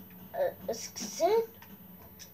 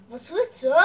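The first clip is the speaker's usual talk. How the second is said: ordinary talk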